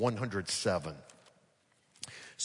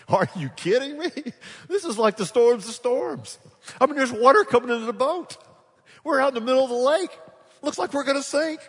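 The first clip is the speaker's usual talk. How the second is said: much higher, loud mock outrage with laughter; exasperated disbelief; 9 dB louder than usual. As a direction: blow up in half-laughing disbelief at Jesus. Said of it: "I just wanna sit there and say".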